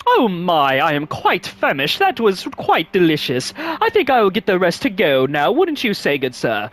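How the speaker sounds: mock-posh English gent, lightly done; hammed-up upper-class British diner; posh British accent. Gamer voicing a fancy restaurant diner, mock posh British accent. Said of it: "in that accent".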